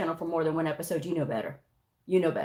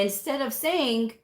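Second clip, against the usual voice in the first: ordinary delivery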